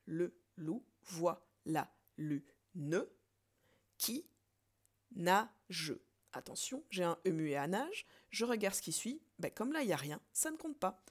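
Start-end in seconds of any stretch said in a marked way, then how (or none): 0.0-5.9 slow and louder, syllables pulled apart; slow syllable count. Shown as a demonstration. Teacher recounting the line slowly for the class. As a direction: count out each beat deliberately. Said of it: "Je reprends".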